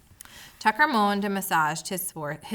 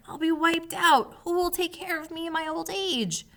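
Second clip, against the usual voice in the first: higher pleading whimper; hammed-up whimpering mouse, higher; 9 semitones higher than usual. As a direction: squeak out the despair, higher. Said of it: "whimpered Tucker".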